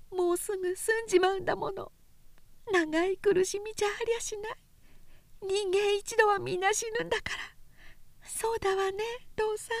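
high, quick, slurring woman's urging; drunken insisting. A narrator's reading. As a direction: nag him drunkenly. Dying drunk woman rambling.

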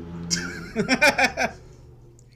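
loud, high laugh; burst of laughter. Real, not acted. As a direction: crack up at the joke. Laughs at the mock oath.

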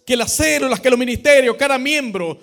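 loud, fervent preaching to the congregation; impassioned pastor rallying his church; preaching at full force. Acted. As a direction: drive the call to unity home from the pulpit. Preaching the climax of the sermon.

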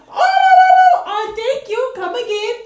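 high, loud mock-shocked oh; mock outrage. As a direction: act scandalised.

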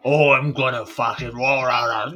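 growled orc roar, trailing into rah-rahs; burly orc voice. Acted. Co-host demonstrating a stock orc character, burly orc voice. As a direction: voice a big burly brute. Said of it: "when I start voicing a character like".

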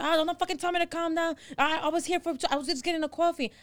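high-pitched, hurried imitation of a complainer; indignant protest. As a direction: mimic a driver making excuses. Acts driver protesting a ticket.

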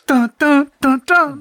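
high dun-dun music sting; imitated dramatic sting. Capping the Snake joke, mock game-over jingle. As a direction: mouth a dramatic four-note sting, high.

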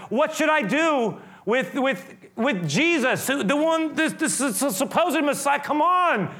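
high-pitched, stumbling plea; acting out a leader losing his grip; exasperated appeal. Pilate appeals to the mob.

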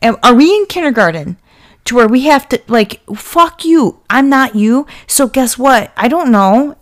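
worked-up real anger; angry rant. Real, not acted. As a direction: let the frustration boil over. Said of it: "I'm getting angry".

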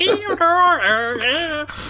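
high screeching electronic squeal; piercing feedback noise done by mouth; imitated mic screech. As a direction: imitate a screaming, glitching microphone. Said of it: "It was, like, properly screaming".

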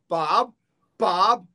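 mock yell to a customer, building to the final Bob; calling out the window. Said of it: "Then I'd be yelling out the window".

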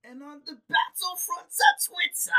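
slow loud shout. Acted. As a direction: bellow it out slowly.

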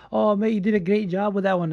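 lightly mocked-up Scottish compliment; Scottish accent. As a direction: imitate a Scot giving praise. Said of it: "Scottish people are so nice. They're like".

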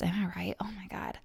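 breathy conspiratorial whisper; half-secret nostalgic whisper; whispered aside. Real, not acted. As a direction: whisper it to the audience.

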